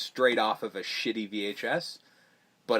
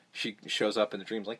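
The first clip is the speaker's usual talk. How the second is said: ordinary delivery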